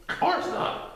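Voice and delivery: high airy critter voice; a bouncy little voice, breathed out; Titter. A narrator's reading. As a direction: Titter agreeing eagerly.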